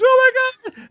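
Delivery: high, loud shrieking Oh my God; aunt's hammed-up horrified scream; imitating aunt's scream. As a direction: shriek like a panicked aunt. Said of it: "she was screaming".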